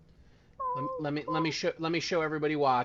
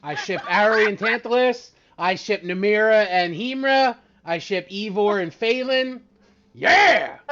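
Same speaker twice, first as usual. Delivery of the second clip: loud, high, gleeful fangirl shout; 9 semitones higher, 15 dB louder than usual. Real, not acted. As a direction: shout it like a giddy fan. Gleefully shipping characters.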